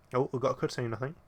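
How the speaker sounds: ordinary talk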